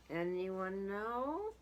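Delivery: ordinary delivery, nothing marked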